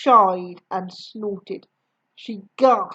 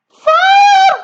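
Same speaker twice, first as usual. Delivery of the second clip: very high, loud yell; 12 dB louder than usual. A narrator's reading. Grandma on fire, very high loud yell. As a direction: scream the word out. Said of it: "the old woman yelled".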